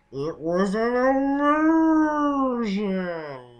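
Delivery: high, loud villain reveal; villain shout. Pretend.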